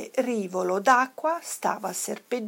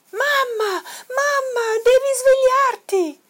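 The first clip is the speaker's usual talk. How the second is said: high, urgent cub calling Mum; excited cry; 7 dB louder than usual. A narrator's reading. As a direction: shout high to rouse a sleeping mother. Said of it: "gridò Toddy".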